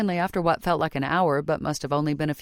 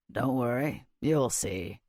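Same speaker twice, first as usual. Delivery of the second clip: smug; 6 semitones lower, 4 dB quieter, slower than usual. A narrator's reading. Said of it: "with an equally smug smile".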